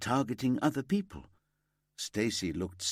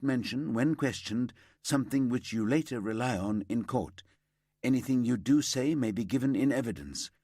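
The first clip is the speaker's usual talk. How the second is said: formal arrest caution; 3 semitones higher than usual. Acted.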